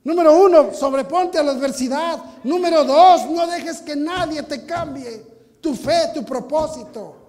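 full-force preacher shouting his points; preaching shout. Acted. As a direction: hammer each point home to the congregation.

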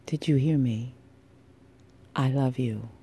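ordinary talk

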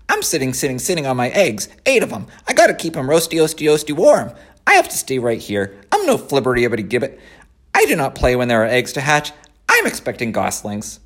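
fussy goose, fast and chattering; a busy goose repeating herself; honking bossy goose. Acted. The goose explains about eggs.

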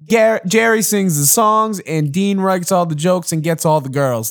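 lightly mocking showman, leading a round of applause; sarcastic emcee calling for applause. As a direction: hype the player up mockingly while clapping. Coach mocking, clapping.

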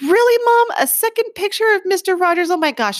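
high, loud mock disbelief; incredulous son. Son catching mom with a second picture, incredulous.

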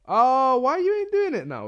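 surprised. Real, not acted. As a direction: caught off guard by the phone. Phone stops warning him.

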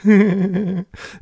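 loud, high fairy giggle; impish giggle. A narrator's reading. Fairy laughing at the children, impish giggle. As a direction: giggle like a mischievous sprite.

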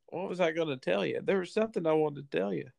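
normal talking voice

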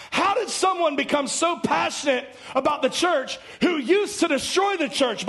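high, pressing preacher's call; fervent preaching. Real, not acted. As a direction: drive the question home with fire. Preacher building fervour.

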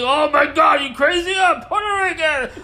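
high, loud, incredulous protest; mock outraged exclaim. Retelling being mistaken for Mexican, high loud outrage. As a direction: act out being insulted over your heritage. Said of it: "And I'm like".

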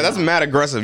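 ordinary talk, nothing marked

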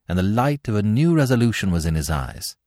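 ordinary delivery

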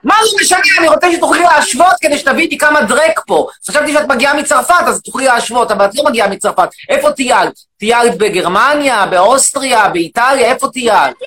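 shouting, indignant rant at the audience; loud mock rant. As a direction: bellow it like an outraged demagogue. Loud persona.

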